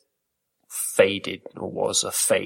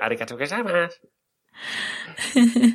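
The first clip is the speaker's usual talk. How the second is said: fake Japanese accent; 7 semitones higher, much faster than usual. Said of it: "they all do that".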